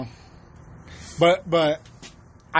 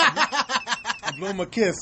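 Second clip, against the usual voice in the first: laughing; 6 semitones higher, much slower than usual. Real, not acted. Laughing retelling.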